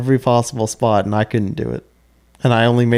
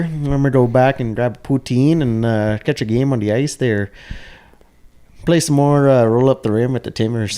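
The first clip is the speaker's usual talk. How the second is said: put-on Canadian accent; 2 semitones higher than usual. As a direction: play the friendly Canadian host, pitched up. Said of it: "In your best Canadian accent".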